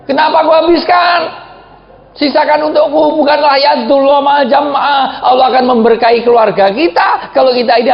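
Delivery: high-pitched shouted reproach; wife's protesting shout. Retold or imitated. Wife demanding her share of the drink, shouting. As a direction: cry out high, holding the line ends. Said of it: "teriak-teriak".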